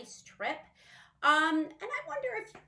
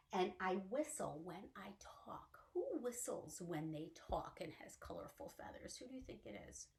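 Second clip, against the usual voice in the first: whisper; 5 semitones lower, 12 dB quieter than usual. Acted. Hushed parrot riddle clue.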